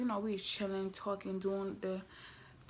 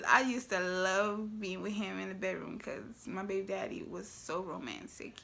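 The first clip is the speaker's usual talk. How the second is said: ordinary talk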